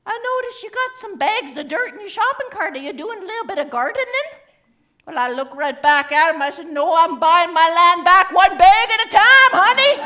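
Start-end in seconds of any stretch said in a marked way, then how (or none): 0.0-4.3 high-pitched, chatty polite stranger; nice gentleman. Retold or imitated. 6.8-9.9 punchline retort, loud and rising. Retold or imitated. Retelling a sharp retort in store.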